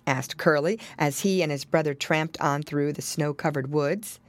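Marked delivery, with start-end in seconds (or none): none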